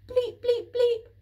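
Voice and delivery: three loud high bleeps; loud high triumphant mock beeps; triple beep. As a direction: beep it out like a keypad. Final keypad beeps.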